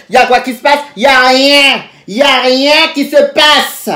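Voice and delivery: loud, high retold outburst; exasperated shout. Waiting idly while nothing happens, exasperated high shout.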